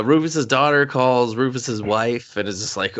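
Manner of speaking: usual speaking voice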